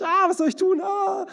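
loud, high-pitched mock panic wail; panicked freak-out. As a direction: wail as if losing it, hammed-up. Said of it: "Wir drehen durch". (gespielt panisches Aufheulen)

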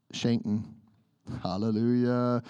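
usual speaking voice

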